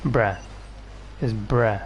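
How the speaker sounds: normal talking voice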